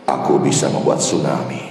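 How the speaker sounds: suara Tuhan yang dalam dan berat; divine voice. Acted. God's voice in sermon story. (suara Allah yang rendah dan berwibawa)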